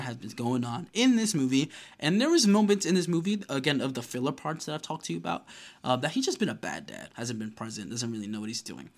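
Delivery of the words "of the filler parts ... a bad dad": ordinary talk, nothing marked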